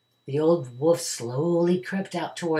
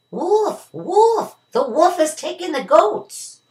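very high, loud panicked shout; shouted cry; 13 semitones higher, 7 dB louder, slower than usual. A narrator's reading. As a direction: yell for help across the hills. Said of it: "the boy's cry came again".

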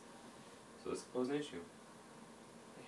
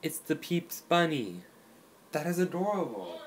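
delighted; 4 semitones higher, 4 dB louder than usual. Real, not acted. Cute bunny on cookie.

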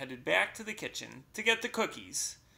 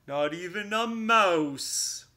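louder, higher recital, slowing at the end; poem recital; 5 semitones higher, 6 dB louder than usual. Acted. Bear reciting the poem at Mouse, pointed. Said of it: "Bear pronounced".

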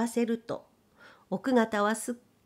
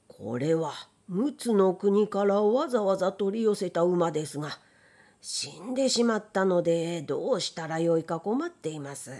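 low, worried man's voice; troubled rider; 4 semitones lower than usual. Acted. Rider telling the young man his horse died, low and troubled. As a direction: sink the voice lower as the trouble sinks in.

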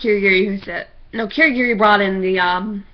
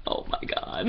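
whispered laugh; 13 dB quieter than usual. Real, not acted.

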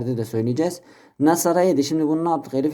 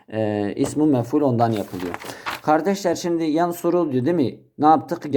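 ordinary delivery

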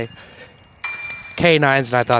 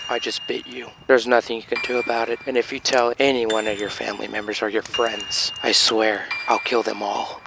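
quiet menace; 5 dB quieter than usual. Retold or imitated. Threatening vampire on phone.

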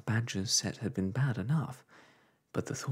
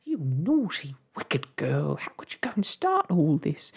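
higher and louder, wailing reproach; wailing scolding; 7 semitones higher, 6 dB louder than usual. A narrator's reading. Mother scolding her daughter, loud wailing reproach. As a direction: scold her through a wail. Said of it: "wailed Homily suddenly".

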